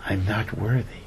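hushed, meek acted humility; humble. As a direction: say it small and low, humbly. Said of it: "because of his great humility".

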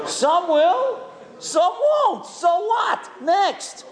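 shouting the motto back, laughing; shouted motto. Real, not acted. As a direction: belt it out with a laugh. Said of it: "That's a great lesson".

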